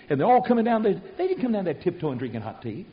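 ordinary talk, nothing marked

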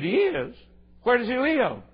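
high-pitched, feigned wide-eyed questions; mock amazement. Preacher humouring a woman's claim, high mock amazement. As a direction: play along, voice jumping way up.

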